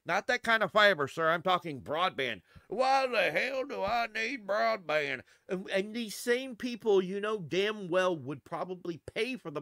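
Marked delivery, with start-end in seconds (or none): 2.7-5.2 a hint of a cranky neighbour; grumpy local. Local scoffing at the fiber canvasser, slow and gruff.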